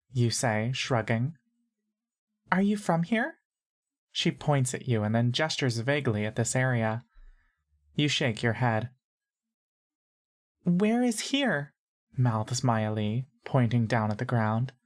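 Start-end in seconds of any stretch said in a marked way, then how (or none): 2.5-3.4 put-on much higher little-girl voice; high-pitched girl. A narrator's reading. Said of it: "and high-pitched". 10.6-11.7 put-on high, slow girlish voice; high-pitched girl. A narrator's reading. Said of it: "and high-pitched".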